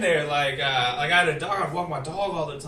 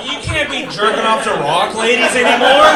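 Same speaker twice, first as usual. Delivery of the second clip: mock scolding; 6 semitones higher, 11 dB louder than usual. Scolding the friend, loud and laughing.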